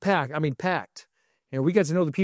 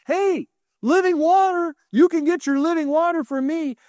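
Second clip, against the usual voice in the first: proclaiming; 8 semitones higher than usual. Retold or imitated. Yeshua standing up to offer living water, proclaiming. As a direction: call it out over a crowd. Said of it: "Yeshua stands up and he says".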